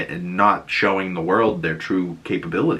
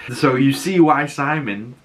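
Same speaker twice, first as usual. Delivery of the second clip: normal talking voice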